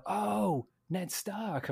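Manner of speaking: light up as the name clicks; mock American excitement; sudden recognition. Imitates Americans suddenly recognising Sean Bean.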